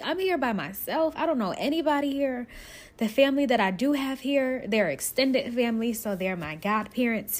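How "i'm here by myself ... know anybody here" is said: high, worried self-talk; retold scared. Newcomer telling herself she is alone, retold fear. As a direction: act out alarm at being all alone, pitched up. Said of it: "I was also scared 'cause I'm like".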